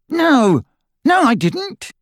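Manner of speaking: very high, frantic denial; boy denying in a panic; desperate denial. A narrator's reading. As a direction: plead that you did not. Boy denies, scared.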